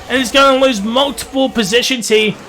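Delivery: usual speaking voice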